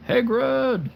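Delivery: high, loud wizard call of the name; a better try, calling out like an old wizard; Dumbledore impression. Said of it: "That's my best, uh, Dumbledore impression".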